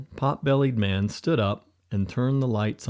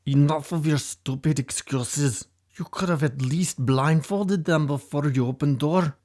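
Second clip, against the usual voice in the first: higher, scornful boss voice berating his men; angry Russian boss; 6 semitones higher than usual. A narrator's reading. Leader scolding the kidnappers who failed, angry. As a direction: sneer at the useless henchmen. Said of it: "the angry Russian leader".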